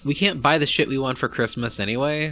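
ordinary delivery

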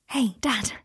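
hushed greeting at a bedside; whispering. A narrator's reading. Son greets weak father.

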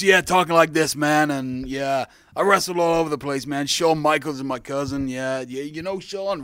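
loud put-on American accent; brash American wrestler, loud. Asked for his old fake persona, loud American accent. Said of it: "give us a little whirl of that American accent now".

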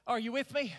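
ordinary delivery

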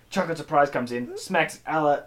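ordinary talk, nothing marked

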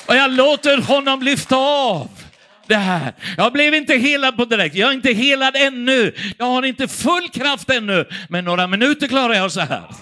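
loud, high impassioned preaching; full-force preaching. Acted. Preacher to congregation, crying out passionately. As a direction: preach it out with rising fervour.

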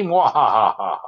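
villainous mwahaha laugh; evil cackle. Pretend. Villain laugh after the quote.

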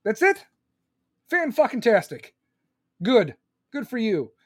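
loud, exaggerated shrug of a dad; hammed-up underwhelmed father; unimpressed dad. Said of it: "I'm gonna be like".

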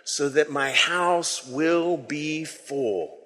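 So that my house will be full chanted very slowly in unison; slow group recitation. Real, not acted. Congregation saying the line together, very slow and soft. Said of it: "Can we say that line together?".

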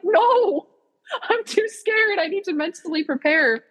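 high, mock frightened squeak; playfully pleading to go last; mock scared whine. To friends in line, a high mock scared whine.

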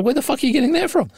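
high, quick mock bafflement; baffled disbelief. Husband retelling his reaction to purple cheese, baffled. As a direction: blurt it out high and fast, incredulous.

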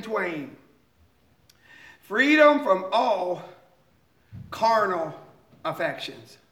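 slow, measured hymn recital; slow reading. Real, not acted. Preacher reads a beloved hymn verse to congregation, slowly. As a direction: let each phrase of the verse soak in. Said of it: "I'm just gonna read them slowly".

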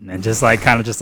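usual speaking voice

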